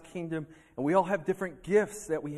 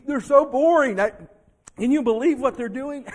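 starting plain, rising to loud high scorn; mock scornful gossip, building; 6 semitones higher, 11 dB louder than usual. Preacher voicing a churchgoer mocking another church, excited scorn. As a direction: get carried away mocking the boring church. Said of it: "I got a little too excited there".

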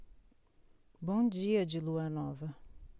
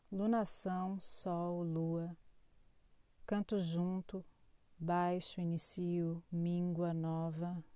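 poetry reading; 3 dB quieter than usual. Acted. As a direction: read it like a spoken poem. Reads a poetic horoscope.

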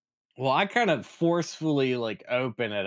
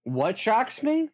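startled question; 10 semitones higher, slower than usual. Acted. Player startled by electric door.

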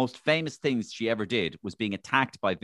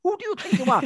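monkey-like high, loud hooting; Vincent Brown; 4 dB louder than usual. Acted. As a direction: hoot the words like an agitated monkey caricature. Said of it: "he used to kinda go".

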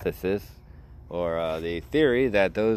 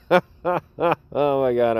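laughing; 5 semitones higher, much slower than usual. Real, not acted. Laughing so hard he tears up.

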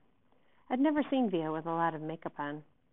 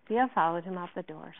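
ordinary delivery, nothing marked

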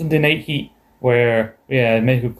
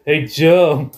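teasing call; 3 semitones higher than usual. Retold or imitated. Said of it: "I love Triple H".

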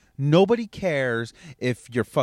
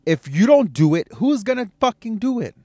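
high, loud, rising demand; loud high rhetorical demand; loud challenge; 9 semitones higher, 7 dB louder than usual. Real, not acted. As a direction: fire the question up high. Rhetorical shout.

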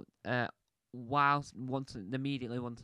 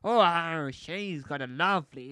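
lightly voiced pirate, louder; a hint of a swooning pirate; 4 semitones higher, 8 dB louder, slower than usual. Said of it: "it's my pirate voice".

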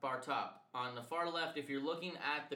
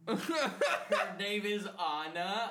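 slow, high and louder through laughter; laughing apology; 6 semitones higher, 7 dB louder, much slower than usual. Retold or imitated. Trainer correcting the new hire's name, laughing. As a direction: apologize while cracking up. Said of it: "And I was like".

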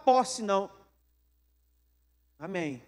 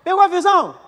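louder, higher punch to the crowd; loud challenge; 5 semitones higher, 12 dB louder than usual. Real, not acted. Preacher to congregation: loud rhetorical challenge. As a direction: throw it out at them.

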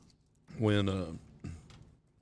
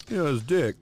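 higher, louder mock-dad clarification; hammed-up fatherly explanation; explaining dad; 6 semitones higher, 6 dB louder than usual. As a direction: spell it out to a confused kid. Dad explaining, acted as skit.

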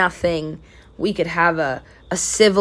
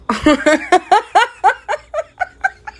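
high, rising burst of laughter; real laugh; 13 semitones higher than usual. Real, not acted. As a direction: laugh helplessly at the memory. Laughing at awkward story.

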